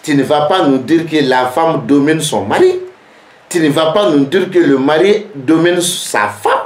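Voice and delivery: ordinary talk, nothing marked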